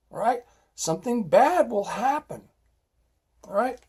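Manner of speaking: fed-up lecture at full volume; exasperated scolding. Real, not acted. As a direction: scold them like a fed-up cop. Scolding people who keep doing stupid things.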